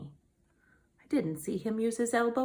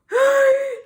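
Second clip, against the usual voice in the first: loud high gasping inhale; shout-gasp before a sneeze; big sneeze gasp; 13 semitones higher, 10 dB louder than usual. Acted. Gerald gasping hard as a sneeze builds.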